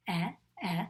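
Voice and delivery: eh, eh isolated before the word; short E sound. Shown as a demonstration. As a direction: sound out eh twice, as in elephant. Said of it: "That's the sound of the letter E".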